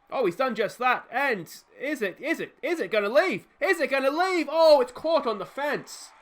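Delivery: rising to a loud, high-pitched call; urgent home-run call, climbing to a peak; building excitement. Real, not acted. Commentator watches if the ball will leave, thrilled.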